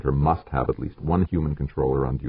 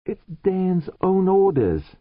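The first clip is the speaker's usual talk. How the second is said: much higher, slow insistence; George's voice; 4 dB louder, slower than usual. Acted. George bluffing the chief with Dan's orders, slow and high. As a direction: press it slowly in a raised voice.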